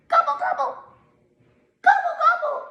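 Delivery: squeaky high turkey gobble. Acted. Turkey Toodles making her hated sound, high squeaky gobble. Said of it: "Toodles hated her sound".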